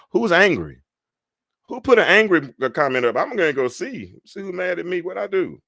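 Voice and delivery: high-pitched, playfully affronted questioning; mock alarm. Host asking who put up an angry emoji, mock alarm. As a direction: raise the pitch, demanding to know who's upset.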